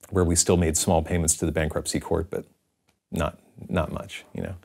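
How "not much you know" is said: ordinary delivery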